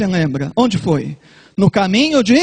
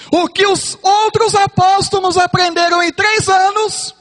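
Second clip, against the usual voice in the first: higher and louder, fiery sermon climax; preaching at full force; 8 semitones higher, 4 dB louder than usual. Acted. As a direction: preach it out with mounting fervour.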